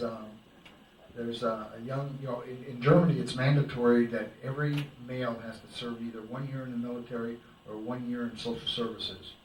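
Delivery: ordinary talk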